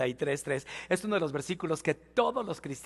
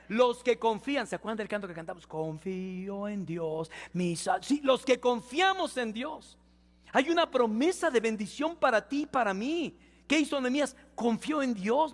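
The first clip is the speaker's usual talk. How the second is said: full-force preaching; 7 semitones higher than usual. Acted. Pastor exhorting the congregation, preaching at full force. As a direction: lift the church with the promise.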